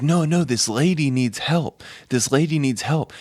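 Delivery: urgent insistence. Retold or imitated. Kid insisting to his friends a lady needs help, urgent. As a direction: imitate a friend insisting, pitch lifted.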